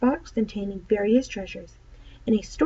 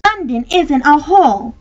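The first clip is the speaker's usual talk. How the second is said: put-on higher, louder indignant girl; 6 semitones higher, 9 dB louder than usual. Polly to Diggory, defending London indignantly. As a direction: defend her city with offended pride. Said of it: "said Polly indignantly".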